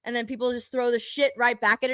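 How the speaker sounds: ordinary talk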